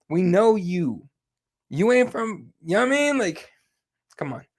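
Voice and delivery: drawn-out words climbing high in disbelief; acted exasperation, stretched and high; exasperated calling-out. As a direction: call out the pretence, pitch soaring. Acted calling-out of her fake accent.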